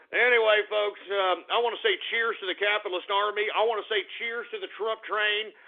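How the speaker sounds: usual speaking voice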